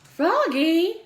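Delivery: high, loud call of his name. A narrator's reading. Mother calling Froggy from another room. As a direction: call him from the other room. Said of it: "called his mother".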